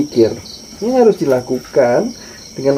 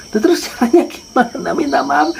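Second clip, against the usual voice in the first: puzzled father; 11 semitones higher than usual. Acted. Father asking, high voice.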